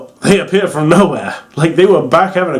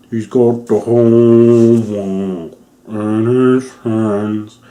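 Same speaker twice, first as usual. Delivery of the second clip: slow, drawn-out imitation of a pitched-down voice; lowered creepy voice; 4 semitones lower, much slower than usual. Imitating the little boy's lowered voice, slow creepy singing. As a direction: drag it out like slowed-down creepy singing. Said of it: "they've lowered his voice, so he sounds like this".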